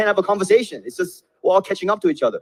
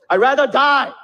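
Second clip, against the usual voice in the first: higher, louder mock refusal; a hint of a defiant patriot; defiant friend's retort; 7 semitones higher, 3 dB louder than usual. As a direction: toss it back like a stubborn buddy. Friend answers the homework plea.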